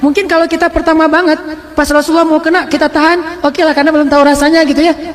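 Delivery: ordinary talk, nothing marked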